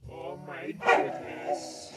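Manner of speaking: dog-talk squeal; high squealing gush. Real, not acted. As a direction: squeal it at the puppy. Gushing over the dog.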